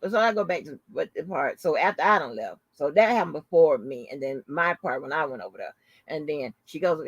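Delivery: ordinary talk, nothing marked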